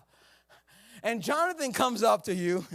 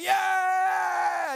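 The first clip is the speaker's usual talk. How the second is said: army cheering; 11 semitones higher, 3 dB louder than usual. Retold or imitated. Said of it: "everybody's like".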